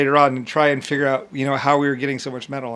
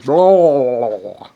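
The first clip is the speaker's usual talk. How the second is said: dismissive blah; 2 semitones lower, 5 dB louder, slower than usual. Retold or imitated. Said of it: "mocking his speeches".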